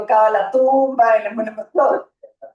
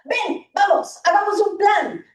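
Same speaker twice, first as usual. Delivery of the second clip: higher, brightly coaxing; cheerful distraction; 7 semitones higher than usual. Retold or imitated. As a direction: pull the child away with forced cheer. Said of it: "distraer a los niños del malestar". (padre que distrae al niño con entusiasmo, voz más aguda)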